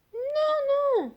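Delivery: very high little cake voice; tiny high refusal; squeaky no. A narrator's reading. Cake refusing the wolf, squeaky high no.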